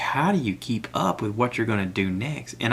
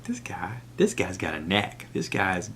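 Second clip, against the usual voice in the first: hushed, softer musing to himself; impressed whisper; 3 semitones lower, 6 dB quieter, slower than usual. Retold or imitated. Watching the farmer's videos, an impressed whisper. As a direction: murmur it in awe. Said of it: "I'm like".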